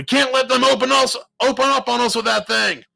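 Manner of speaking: loud, high urgent shout; alarmed, high-pitched yell; shouted warning. A narrator's reading. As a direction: yell to your crew under fire. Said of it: "Hardy shouted as".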